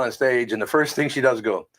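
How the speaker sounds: ordinary talk, nothing marked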